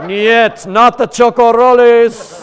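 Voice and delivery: loud, high, slow mock Russian-Mexican accent; bad Russian accent. Russians begging to keep their chocorroles, in a mixed Russian-Mexican accent. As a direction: plead for the snacks in a jokey Russian accent. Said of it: "I can't do a Russian accent, Russian, Mexican, kind of mixed in".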